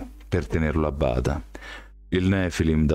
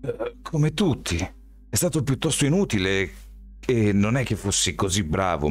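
put-on shrugging young man; 6 semitones higher than usual. A narrator's reading. Miles answers Daniel about fencing class, offhand higher voice. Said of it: "Hai seguito un corso".

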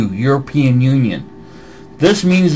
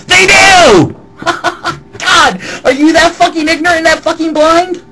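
high, loud furious yell; furious shout; 13 semitones higher, 4 dB louder than usual. Real, not acted. Yelling back at the commenter's question, furious and loud. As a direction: blow up at him, full volume.

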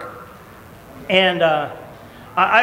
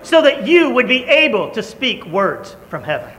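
preaching; 6 semitones higher than usual. Acted. Preacher urging the congregation to change their world, building fervor. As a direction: preach it with growing fire.

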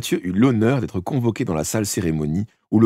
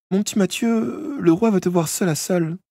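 forced smile; 13 semitones higher than usual. A narrator's reading. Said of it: "répondit avec un sourire forcé".

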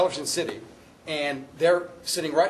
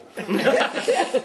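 real laughter; 9 semitones higher, 5 dB louder than usual. Real, not acted.

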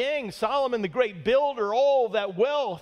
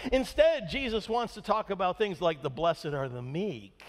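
ordinary talk

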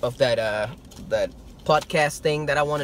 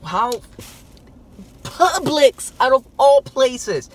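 amazed; 7 semitones higher, 6 dB louder, much slower than usual. Real, not acted. Amazed at the unlikely store.

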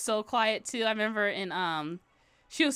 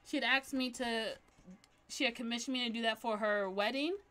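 ordinary talk

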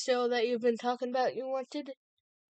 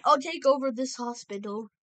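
usual speaking voice

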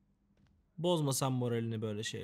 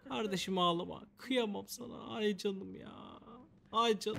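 high, soft cooing to a crying friend; mock tender pity, much higher and softer; cooing sympathy; 8 semitones higher, 5 dB quieter, slower than usual. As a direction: coo over him like a baby. Comforting the crying sim.

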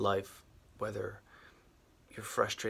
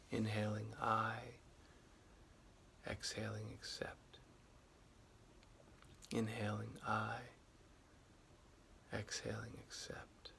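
guided meditation; 8 dB quieter than usual. Acted. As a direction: guide the listener gently back to the breath. Leads a guided acceptance meditation.

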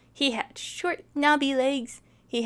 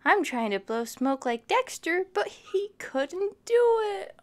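giraffe straining to blow; 6 semitones higher than usual. Acted. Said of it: "He said".

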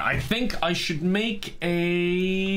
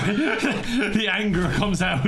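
laughing through it; 7 semitones higher, much slower than usual. Real, not acted. Laughing at a dead meme.

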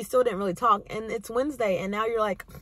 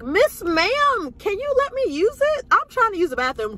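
much higher, louder pleading; exasperated plea; 11 semitones higher, 4 dB louder than usual. Retold or imitated. Pleading with a chatty lady by the toilet, high and loud. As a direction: beg her to let you pee. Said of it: "I was like".

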